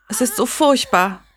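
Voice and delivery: usual speaking voice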